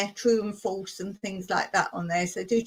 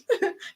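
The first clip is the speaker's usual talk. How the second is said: laugh; 10 semitones higher, 4 dB quieter than usual. Real, not acted. Laughing at own joke.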